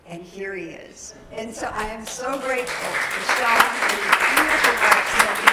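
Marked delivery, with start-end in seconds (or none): 1.3-5.5 loud, glowing announcement of thanks; beaming loud welcome to the congregation; joyful welcome. Real, not acted. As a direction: proclaim your gratitude to the whole room. Joyful welcome of guests in church.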